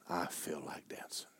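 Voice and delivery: soft unvoiced whisper to God; whispered prayer. Preacher retelling his quiet prayer to God, whispering. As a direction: confide it in a whisper.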